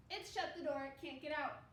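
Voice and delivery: normal talking voice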